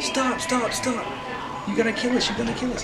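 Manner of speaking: high, frantic pleading to stop; mock-terrified high voice; freaking-out British family. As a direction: passengers begging the driver to stop. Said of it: "British family freak out".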